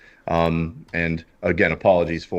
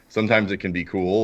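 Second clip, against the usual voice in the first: ordinary talk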